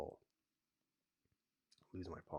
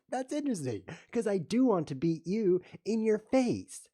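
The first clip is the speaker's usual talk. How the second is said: mock taunt; 11 semitones higher, 8 dB louder than usual. Taunting the opponent he wants to beat, mock threat. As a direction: drag it out to mock him.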